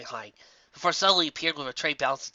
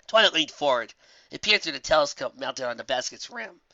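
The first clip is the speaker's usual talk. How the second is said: ordinary delivery, nothing marked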